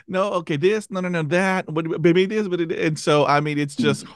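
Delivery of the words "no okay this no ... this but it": high, fast, scattered thoughts; rushing through options; flustered. Retold or imitated. Retells his own flustered thoughts.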